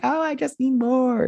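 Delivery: giddy fangirl gush, high and breathless with delight; gushing squeal. Retold or imitated. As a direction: squeal it like a swooning fan. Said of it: "I'm just like".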